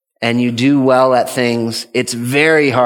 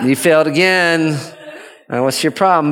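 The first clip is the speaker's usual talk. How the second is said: higher, scolding mock-mean parent; 5 semitones higher than usual. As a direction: scold meanly, put on for show. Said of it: "in a mean way".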